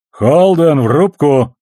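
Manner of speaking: loud, high-pitched call over the ship's intercom; captain's intercom summons. A narrator's reading. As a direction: call him to the bridge like a captain on the PA. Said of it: "Голос капитана Макдауэлла прозвучал гулко и невнятно".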